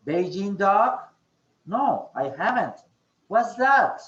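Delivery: exaggerated teaching intonation, big swoop on "that"; expressive intonation. Shown as a demonstration. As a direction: act out puzzled surprise, swooping on "No" and "What's that". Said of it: "certain emotions on what you're speaking".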